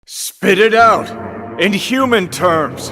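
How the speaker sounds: loud, slow, high angry shout; angry demand. Acted. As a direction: bark each word, losing patience. Frustrated demand at Alt.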